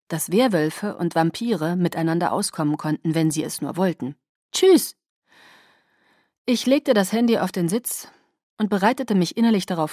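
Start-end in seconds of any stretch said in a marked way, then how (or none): 4.5-5.0 high, loud, cheery sign-off; perky phone goodbye; bright goodbye. Acted. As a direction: sing out a quick farewell.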